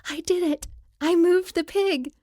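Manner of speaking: high, thrilled little cheer; giddy with triumph, high-pitched; proud, excited exclamation in a high voice. A narrator's reading. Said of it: "whisper excitedly".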